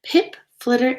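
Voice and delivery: usual speaking voice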